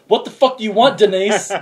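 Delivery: a hammed-up rude phone greeting; mock rude answer. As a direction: bark at the caller. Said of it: "answer to the phone".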